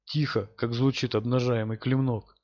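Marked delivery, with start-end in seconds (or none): none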